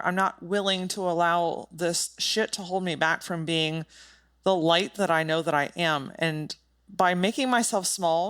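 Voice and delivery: usual speaking voice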